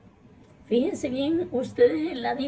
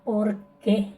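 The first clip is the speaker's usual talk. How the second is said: usual speaking voice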